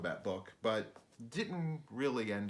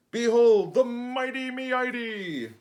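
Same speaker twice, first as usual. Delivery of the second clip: loud, slow, booming proclamation; loud slow herald voice; grand announcer; 14 semitones higher, 13 dB louder, slower than usual. Acted. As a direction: present it like a ringmaster.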